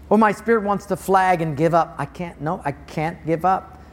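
ordinary delivery

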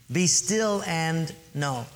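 very slow, measured; slow, deliberate verse; slow emphasis. Real, not acted. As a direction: lean on each word. Repeats key verse for emphasis.

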